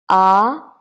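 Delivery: AH rising, loud; second tone. Shown as a demonstration. Teacher drilling first line, ah rising. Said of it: "now let's have a try".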